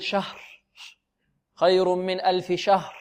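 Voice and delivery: "شهر" trailing into breath with no audible r; devoiced final r. Teacher imitates students dropping the r, breathy ending. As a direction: swallow the r of "شهر" into voiceless air. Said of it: "أين الراء؟".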